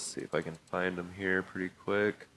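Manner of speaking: ordinary delivery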